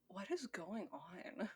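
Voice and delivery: slow, hushed bewilderment; taken aback. Retold or imitated. Host recalling reading the singer's credits, softly taken aback. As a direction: drop to a stunned, drawn-out near-mutter. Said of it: "taken aback when I read that".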